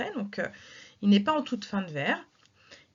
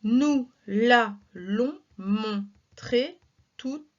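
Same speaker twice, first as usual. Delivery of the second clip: very slow, syllables spaced out; dragged verse recitation; slow scansion; much slower than usual. Shown as a demonstration. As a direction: count each syllable slowly.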